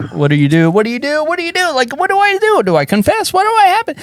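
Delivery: rising, squeaky self-interrogation; mock inner panic. Comic asks himself what to do on stage, acted panic.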